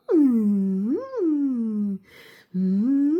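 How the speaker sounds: bright contented hum, rising; happy humming. Shown as a demonstration. Showing how she stims with her sweater, happy hum. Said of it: "I love to go".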